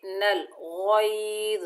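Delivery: नल गैज़ slow and merged; joined nun and lam. Shown as a demonstration. Teacher demonstrating the merged नल. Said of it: "नून को लाम से मिलाएंगे".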